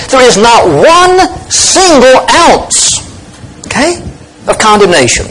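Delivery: NOT ONE SINGLE OUNCE high and drawn out word by word; emphatic stress. Shown as a demonstration. Preacher rephrasing no condemnation, emphatic stress on NOT ONE SINGLE OUNCE. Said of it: "Try another way".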